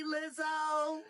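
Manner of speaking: ordinary talk, nothing marked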